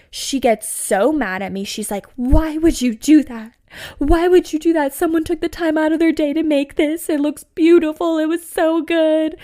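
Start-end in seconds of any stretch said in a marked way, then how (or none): 2.2-9.4 high, loud, wailing protest; mock crying outrage. Imitating her upset friend scolding her over the toppled rocks, high tearful wail. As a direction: whine it like a tearful drunk friend. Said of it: "She's crying".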